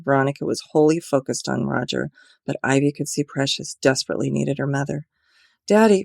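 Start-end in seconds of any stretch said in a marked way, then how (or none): 5.7-6.0 higher, louder wailing cry. A narrator's reading. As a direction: wail for her father. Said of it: "Precious wailed".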